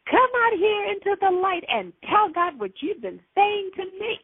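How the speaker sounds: high-pitched mock yelled order; imitated yell. Woman ordering the demon into the light, high mock yelling. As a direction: call it out high, without full volume. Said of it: "and I yelled".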